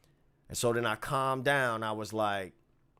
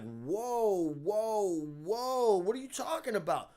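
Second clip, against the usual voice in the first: caught off guard; 8 semitones higher, slower than usual. Retold or imitated.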